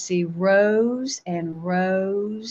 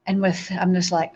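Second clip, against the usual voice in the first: ordinary delivery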